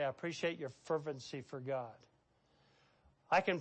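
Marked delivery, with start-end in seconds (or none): 0.0-2.0 tender praise. Shown as a demonstration. Pastor voicing praise to Josh.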